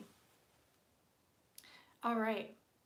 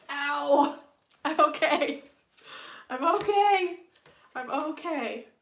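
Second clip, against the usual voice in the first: loud, high real ouch then reassuring; yelp, then insist you're fine; hit my head; 3 semitones higher, 11 dB louder, slower than usual. Real, not acted. Streamer bumps her head on camera, real loud ouch and reassurance. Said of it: "and I hit my head".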